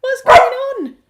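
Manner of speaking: very high, loud squealed question; startled pet talk. Real, not acted. Owner to suddenly barking dog, high startled squeal.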